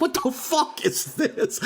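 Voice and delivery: high, stretched-out disbelief; retold bewilderment with laughter around it; incredulous. Retold disbelief at toy karaoke mic.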